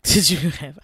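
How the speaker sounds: real laugh breaking through, loud; cracking up mid-question; can't get the words out for laughing. Real, not acted. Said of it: "I'm sorry that I'm laughing".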